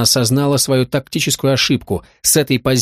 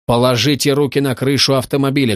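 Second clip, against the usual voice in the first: plain reading voice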